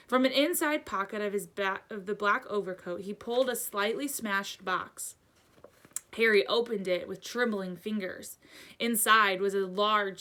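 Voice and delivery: plain reading voice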